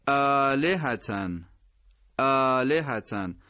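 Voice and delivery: آلهتن slow, hamza voweled; careful recitation; separated hamza. Shown as a demonstration. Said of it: "همزه فاصله داره".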